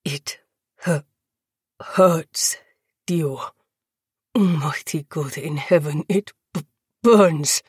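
halting, stammered words, mostly low; agonised. A narrator's reading. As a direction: struggle through burning pain, breaking words apart. Tortured prisoner stammering in agony.

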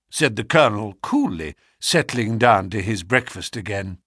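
ordinary delivery

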